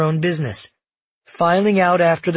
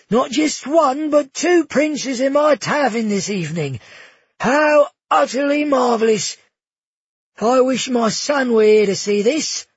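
gushing innkeeper; 9 semitones higher, 3 dB louder than usual. Acted. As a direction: fawn over the princes in a high, thrilled voice. Bruno, delighted tavern keeper.

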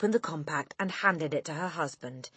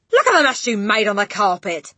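loud scolding yell; angry shout; 4 semitones higher, 10 dB louder than usual. A narrator's reading. Father to wife over dropped plate, angry shout. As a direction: shout about the mess. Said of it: "he shouted".

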